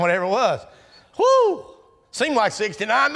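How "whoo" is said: high loud whoop; sudden high whoo. Real, not acted. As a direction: let out a laughing whoop. Joking about marriage years.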